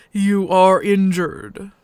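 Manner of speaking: a hint of a gruff alien dog-man; slow, heavy foreign-sounding voice; gruff alien husky. The husky speaking to the stranger, lightly gruff.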